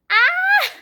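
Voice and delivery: high loud squeal; excited shriek. Real, not acted. Reader squealing at a family scene, high and loud.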